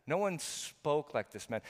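"spoke" stretched out slowly from its first s; slow wonder, drawing out the word "spoke"; stress on spoke. Retold or imitated. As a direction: lean long and hard on "spoke". Repeats the officers' marvel at the words.

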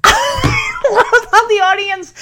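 laughing through the words, high and drawn out. Real, not acted. Recapper delighted by the audience reaction, laughing.

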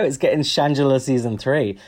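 usual speaking voice

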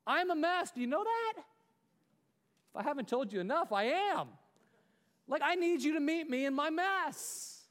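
high-pitched preacher's outburst; impassioned confession. Real, not acted. Preacher admitting to God and church he's a mess, impassioned. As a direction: cry out your own need from the pulpit.

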